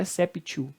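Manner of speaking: exceptio with a hard T, "esséptio"; mispronounced exceptio, T kept instead of an S sound; wrong T pronunciation. Shown as a demonstration. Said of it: "tá errado também".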